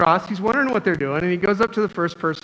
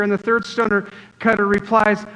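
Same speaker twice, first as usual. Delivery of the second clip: normal talking voice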